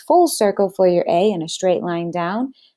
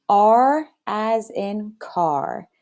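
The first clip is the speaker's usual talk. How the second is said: R as in car again slowly with class; slow choral model, stretched; slow repeat; much slower than usual. Shown as a demonstration. Kids repeating R as in car slowly after teacher. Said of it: "Say this with me".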